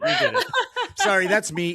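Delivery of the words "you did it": jumps way up and louder, laughing; excited teasing squeal. Real, not acted. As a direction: squeal the accusation in delight.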